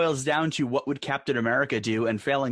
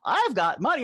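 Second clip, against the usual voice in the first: lightly voiced smug billionaire, pitched higher; a hint of a rich man bragging; smug Mike Bloomberg; 10 semitones higher than usual. Bloomberg showing up to the squabble, lightly mocked smug boast. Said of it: "Mike Bloomberg coming and being like".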